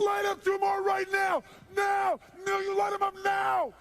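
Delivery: high, full-force screamed command; crazy shouting. Retold or imitated. Therapist overriding the smoker's refusal, screaming. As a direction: scream each order like a madman. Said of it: "I start acting crazy".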